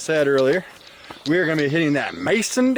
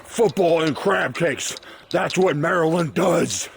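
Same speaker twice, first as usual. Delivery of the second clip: slow, higher-pitched movie quote; hammed-up film line, stretched out and pitched up; movie character impression; 4 semitones higher, slower than usual. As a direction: imitate a movie character, drawing out each word. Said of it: "He's like".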